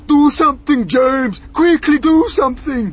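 high, loud frantic pleading; panicked cry. A narrator's reading. Earthworm begs James to act, frantic shouting. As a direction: beg urgently at full voice. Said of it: "cried the earthworm".

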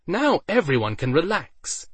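cheerfully. A narrator's reading. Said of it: "Nen said cheerfully".

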